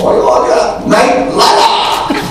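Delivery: high, drawn-out excited "Knight Rider" with a Japanese L; laughing Japanese dub voice; Japanese dub imitation. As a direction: mimic the Japanese dubbing, laughing through it. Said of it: "they speak Japanese and they go".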